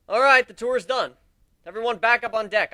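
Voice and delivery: loud, high call to the group. Acted. Tour guide calling everyone back on deck, loud. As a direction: announce it across the room.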